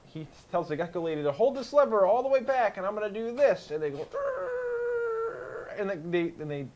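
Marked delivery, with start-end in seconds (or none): 4.1-5.7 long high-pitched engine whine imitation; drawn-out high "arrr" noise; high strained cry. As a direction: squeal it out like a straining ship. Imitates the lever pull.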